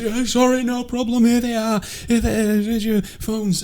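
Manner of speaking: high, timid little apologetic voice; meek victim. Retold or imitated. Robbed man handing phones to his attacker, meek high voice. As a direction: hand it over apologetically, pitched right up.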